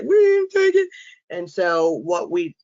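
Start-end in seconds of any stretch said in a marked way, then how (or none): 0.0-0.9 loud, very high mock denial; acted protest. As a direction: protest innocence in a high, raised voice. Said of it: "we were all just like".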